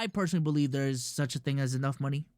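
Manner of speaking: normal talking voice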